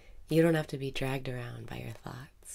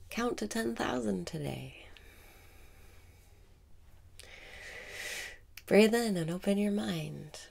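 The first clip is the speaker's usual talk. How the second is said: guided meditation. Acted. Meditation teacher guiding listeners through breathing, calm meditation voice.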